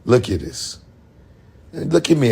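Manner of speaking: normal talking voice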